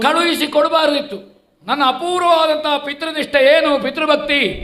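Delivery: high-pitched heroic stage oratory; impassioned declamation. Acted. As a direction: declaim it grandly to the hall. Prince extols his devotion to father.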